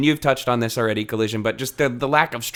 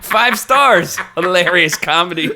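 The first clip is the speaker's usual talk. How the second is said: higher, louder, mock-enthusiastic; hammed-up fake praise; mock rave review; 6 semitones higher, 6 dB louder, slower than usual. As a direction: rave about it like a sarcastic critic.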